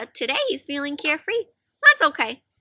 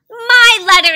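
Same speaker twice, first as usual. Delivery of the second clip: loud, higher insistence; insisting; 8 semitones higher, 13 dB louder than usual. Acted. Hedgehog stealing the letter, insisting loudly. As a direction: stake your claim loudly. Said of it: "insisted Hedgehog".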